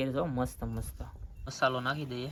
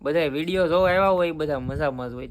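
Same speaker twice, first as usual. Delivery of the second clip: vlog greeting; 3 semitones higher, 4 dB louder than usual. Acted. Vlog opening greeting to viewers.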